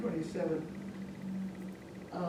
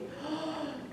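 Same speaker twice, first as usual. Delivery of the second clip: soft, high intake of breath; frightened gasp; 8 semitones higher, 8 dB quieter than usual. Acted. Father seeing the cat's teeth, frightened gasp.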